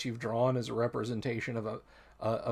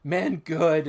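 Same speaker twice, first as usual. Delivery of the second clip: fawning kobold; 5 semitones higher, 7 dB louder than usual. Acted. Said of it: "and he's fawning on you".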